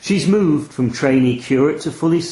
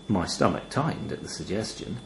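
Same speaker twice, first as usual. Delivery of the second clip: usual speaking voice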